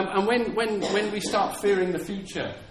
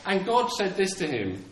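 ordinary delivery

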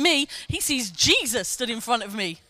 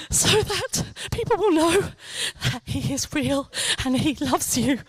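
retold prayer; 5 semitones higher than usual. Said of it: "I said".

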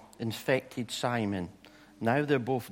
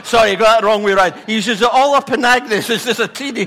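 loud, fast, laughing through the words; laughing apology; 7 semitones higher, 13 dB louder, faster than usual. Real, not acted. Preacher correcting his slip to the congregation, laughing. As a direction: correct yourself while still cracking up.

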